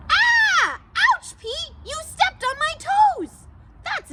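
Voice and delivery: a little friend yelping as a foot lands on her toes; squeaky ouch. Acted.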